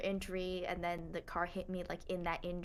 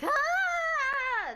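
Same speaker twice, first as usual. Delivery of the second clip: high, loud bleating goat-like screech; goat scream; 8 dB louder than usual. Retold or imitated. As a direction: shriek like a goat. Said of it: "screamed like a goat".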